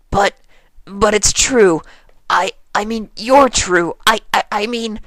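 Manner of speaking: high, loud nervous stammer; flustered stammer. Acted. Rainbow panicking under AJ's stare, high stammer. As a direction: trip over the words in a panic.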